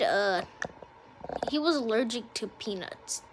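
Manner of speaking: ordinary delivery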